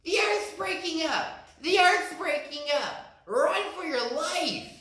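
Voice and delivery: high panicked rabbit shout; high, urgent rabbit shout; frantic shout. Acted. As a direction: shout the warning while fleeing. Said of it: "just shouted".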